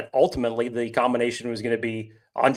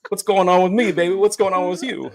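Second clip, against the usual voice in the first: high, loud hammed-up Grease impression; Danny Zuko; 7 semitones higher, 5 dB louder than usual. Talking to Sandy for the first time, hammed-up impression. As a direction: belt it out like a cocky movie greaser. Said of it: "when he's talking to Sandy".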